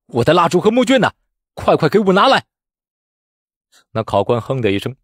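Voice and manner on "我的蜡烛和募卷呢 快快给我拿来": high, imperious shout; loud demand. A narrator's reading. Candidate grabbing the examiner for his exam supplies, shouting. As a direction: order him about triumphantly. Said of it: "高声喝道".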